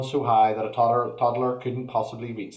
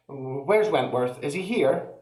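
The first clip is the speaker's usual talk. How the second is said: higher, slow, worried mother; urgent; 4 semitones higher, slower than usual. Acted. Mother searching for her son, urgent and worried. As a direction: ask in rising panic. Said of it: "asked urgently".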